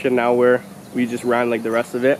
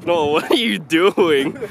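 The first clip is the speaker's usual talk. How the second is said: usual speaking voice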